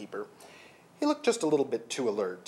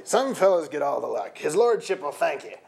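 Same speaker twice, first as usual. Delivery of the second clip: a light rough guard voice; a hint of a laughing sentry; jolly sentry; 4 semitones higher, 5 dB louder than usual. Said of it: "The sentry laughed raucously".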